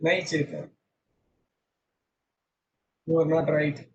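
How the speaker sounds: ordinary talk, nothing marked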